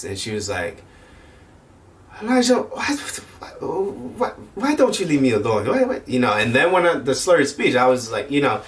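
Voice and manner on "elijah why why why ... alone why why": put-on high, pleading old lady voice, much higher; high-pitched imitation of a confused grandma; imitating grandma. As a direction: mimic the frail grandmother begging to be left alone. Grandma voiced in retold story.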